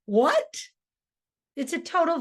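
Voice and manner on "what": Meg's raised what. Acted. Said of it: "And then she says".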